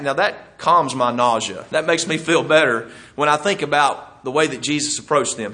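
ordinary talk

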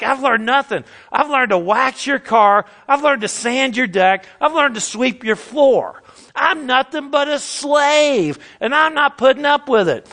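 student explodes at Mister Miyagi in rage; exploding in rage. Retold or imitated. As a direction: let the student blow up at his master. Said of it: "in a fit of rage, he just explodes".

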